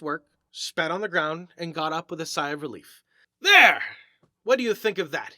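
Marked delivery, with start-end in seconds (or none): 3.4-5.3 a loud proud "There!" then a pleased question; proud exclamation. Acted. Mason showing the narrator his finished gravestone, proudly. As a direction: show off the finished stone with pride. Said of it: "with an air of evident pride".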